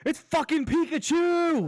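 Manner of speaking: very high, loud, drawn-out shout; excited yell. Real, not acted. Player spots Pikachu in the game, excited yell.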